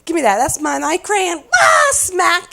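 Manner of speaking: put-on high, loud squabbling children; mock kids fighting. Kids fighting over crayons in the car, loud and high. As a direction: act out bickering kids. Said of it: "you know, fighting".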